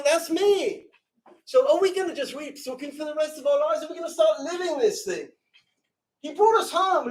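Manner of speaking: high, urgent, pleading fervour; fervent, high-pitched exhortation; impassioned preaching. Real, not acted. Impassioned appeal at sermon's climax.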